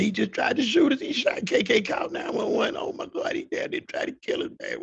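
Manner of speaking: high, rushed, frantic telling; telling mama about the shooting, in panic; panicked report. Retold or imitated. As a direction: blurt it out still shaking. Panicked telling mama what happened.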